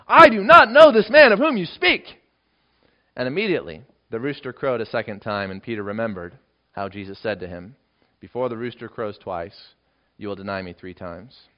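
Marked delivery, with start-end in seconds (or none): 0.1-2.2 loud, high cursing denial; swearing denial. Acted. Peter denying Jesus to the bystanders, loud cursing oath. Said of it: "invoke a curse on himself and to swear". 8.2-11.5 soft, low recollection; quiet remembered words. Retold or imitated. Peter remembering what Jesus said, quiet and low.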